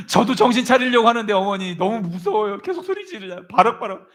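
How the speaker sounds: high, loud protest to a mother; hammed-up frightened whine; scared son pleading. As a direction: plead helplessly, pitched well up.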